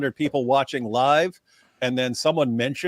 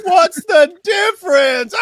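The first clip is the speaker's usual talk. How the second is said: very high, slow, shouted cartoon punchline; high shouted mock cartoon voice; 11 dB louder, slower than usual. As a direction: yell the punchline like a dopey cartoon. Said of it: "And Patrick goes".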